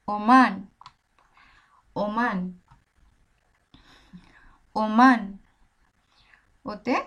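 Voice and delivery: ওমান with a clean Bengali ও sound; ওমান drilled, ও unchanged; pronounce as O. Shown as a demonstration. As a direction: repeat ওমান, O as in ও. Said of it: "ও এর মতোই উচ্চারণ".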